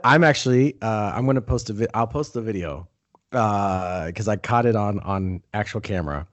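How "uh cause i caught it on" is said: ordinary talk, nothing marked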